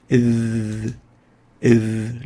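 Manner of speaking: a heavy, throaty "Iv"; ظ مفخمة; emphatic Arabic ظ. Shown as a demonstration. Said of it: "Lingual dental".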